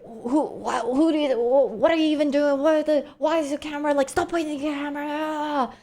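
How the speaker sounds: high, garbled angry rant without real volume; mock shouting. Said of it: "he kept shouting and was just like".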